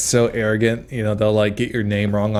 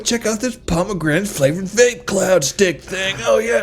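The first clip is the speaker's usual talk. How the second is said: high sing-song bro; 10 semitones higher than usual. Retold or imitated. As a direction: show off like a vape bro. Bro barista voiced in bit.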